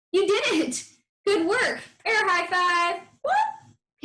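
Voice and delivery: put-on high, bright cheer for the kids; excited praise. Teacher cheering young readers after a correct word. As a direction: celebrate like they just aced it.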